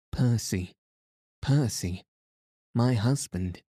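a hint of joyful relief on finding him; breathless joy. A narrator's reading. Wife finding her husband alive, lightly gasping with joy. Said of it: "she gasped, faint with the fullness of her joy".

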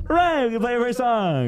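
high, breezy frontman line; mock band frontman. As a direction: toss it off like a cocky singer. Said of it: "And they're like".

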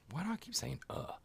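breathy whisper to himself; whispered aside. Real, not acted. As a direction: mutter it under your breath. Aside to himself mid-intro.

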